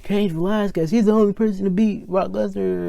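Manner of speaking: a light Michael Cole hype voice; imitating hype commentator. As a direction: sell him like a commentator hyping the crowd. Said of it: "Michael Cole's hyping him up like".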